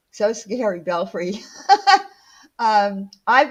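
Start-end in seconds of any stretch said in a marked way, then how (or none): 1.4-2.1 loud high laugh at her own joke; real laughing fit; burst of laughter. Real, not acted. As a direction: crack up laughing.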